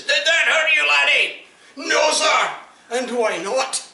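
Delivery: sergeant shout. Acted. Sergeant shouts at the soldier he just kicked, very high and loud. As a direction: scream it at the ranks.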